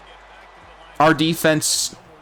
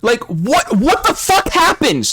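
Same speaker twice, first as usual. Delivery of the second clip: frustrated yelling; 9 semitones higher than usual. Real, not acted. Raging at the game.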